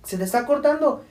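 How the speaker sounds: high voice calling into a phone; acted phone confusion. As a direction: imitate struggling with a bad line. Nephew reacts to call cutting out.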